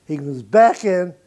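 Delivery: ordinary talk, nothing marked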